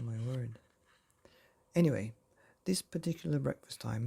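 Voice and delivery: ordinary delivery, nothing marked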